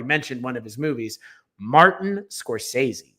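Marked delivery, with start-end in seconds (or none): 1.6-3.1 slow, weighty announcement of the name; drawn-out reveal. Real, not acted. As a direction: draw out "Martin Scorsese" like a big reveal. Dramatic reveal of controversial pick.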